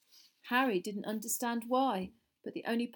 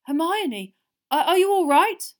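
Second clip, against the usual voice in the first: high, loud, faltering worry; shaky; 8 semitones higher, 12 dB louder than usual. A narrator's reading. Father checking the children are safe, shaky voice. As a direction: stammer it out, anxious for them. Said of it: "His voice sounded shaky".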